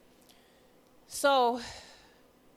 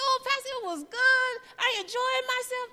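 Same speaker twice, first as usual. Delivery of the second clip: bright mock politeness; 13 semitones higher, 5 dB louder than usual. Her own reply to pastor.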